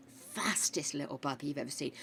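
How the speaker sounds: soft fond whisper. Real, not acted. Whispering fondly about the late dog Stitch. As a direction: whisper it, full of affection.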